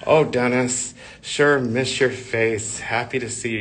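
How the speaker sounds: ordinary talk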